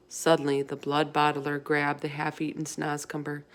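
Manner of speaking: usual speaking voice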